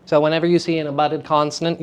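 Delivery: ordinary talk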